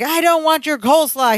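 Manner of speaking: high-pitched irritated quote; mock angry. Larry refusing his cousin's coleslaw, mock angry. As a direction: imitate a cranky sitcom character. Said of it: "He's just angry".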